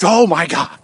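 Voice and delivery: high, loud mock exclamation; imitated loud outcry; film character exclaiming. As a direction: blurt it out as the man in the film.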